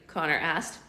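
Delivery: plain reading voice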